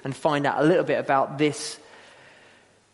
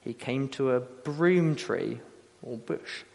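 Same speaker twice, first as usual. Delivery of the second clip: soft, measured reading aloud; solemn scripture reading; 3 semitones lower, 5 dB quieter than usual. Acted. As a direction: read the passage quietly, letting the ending sink. We read this.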